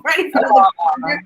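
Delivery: words rushed out through a laugh; host laughing about melting on camera. Real, not acted. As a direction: laugh it off, quick.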